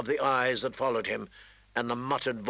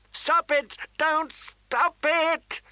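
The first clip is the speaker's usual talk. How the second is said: high, loud scream, the last words drawn out slowly; 14 semitones higher, 4 dB louder, much slower than usual. Acted. Sansa screaming at her sister and the prince, high and loud. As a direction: scream it out in panic. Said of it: "Sansa screamed".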